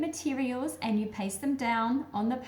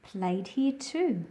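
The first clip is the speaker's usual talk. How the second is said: put-on reading to children. A narrator's reading. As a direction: read the picture book aloud to little listeners, unhurried. Reads picture book aloud.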